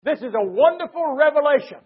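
ordinary delivery

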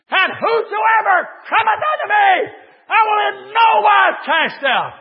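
high, loud, shouted revival preaching; full force preaching. Acted. Preacher tells how Jesus called him as a boy, shouting. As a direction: cry it out like a revival altar call. Preacher telling his salvation call.

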